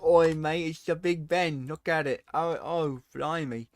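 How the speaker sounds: hammed-up Australian slang voice; light Australian accent, a bit high; mock Aussie. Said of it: "it's Australian speak".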